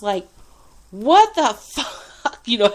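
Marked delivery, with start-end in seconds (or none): 0.9-2.0 loud, drawn-out exasperated cry; mad outburst. Retold or imitated. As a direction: retell your outrage, louder and higher, stretching the words. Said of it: "I was so mad".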